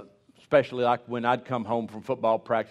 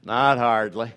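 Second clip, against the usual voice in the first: John Wayne; 7 dB louder, slower than usual. Pretend. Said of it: "He might have said".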